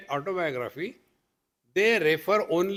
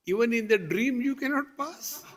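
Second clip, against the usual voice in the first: higher, lightly mocking father's voice; scolding father; 6 semitones higher than usual. Father scolding his child over a failed dream exam, lightly mocked. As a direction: rise in incredulous reproach, amused. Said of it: "So the father said".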